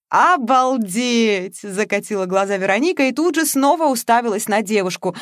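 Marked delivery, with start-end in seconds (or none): 0.1-1.6 drawn-out amazed exclaim. Acted. Протяжно и восхищённо: «Обалдеть!». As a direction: stretch it out slowly, high and loud, in awe.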